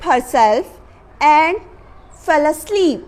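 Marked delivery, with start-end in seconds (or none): none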